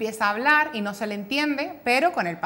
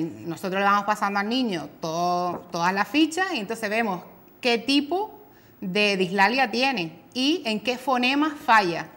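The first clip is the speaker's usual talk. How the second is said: ordinary delivery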